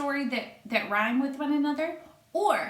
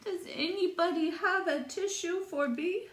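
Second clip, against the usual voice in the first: higher, pleading tiny flea voice; flea's plea; 8 semitones higher than usual. Acted. Stuffed-up flea begging the barn animals, high pleading. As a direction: beg for a tissue like a little bug. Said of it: "his garbled plea".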